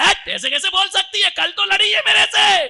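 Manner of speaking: high, loud astonished outburst; father hears his angry wife sent him love; surprised loud father. Retold or imitated. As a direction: blurt it out in happy disbelief.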